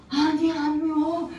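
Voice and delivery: slow, mumbled a touch high; drawn-out reassurance from a drunk; husband's answer. Retold or imitated. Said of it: "이러는 거야".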